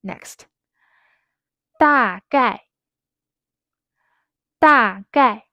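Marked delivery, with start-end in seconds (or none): 1.8-5.4 "Da" and "gai" each falling sharply, loud; two firm falling syllables, "Da gai"; fourth tone twice. Shown as a demonstration. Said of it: "is fourth tone, fourth tone".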